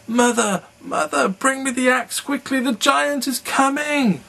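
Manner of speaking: very high, loud panicked calling; frantic shout. Acted. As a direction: Jack yells to his mother while fleeing.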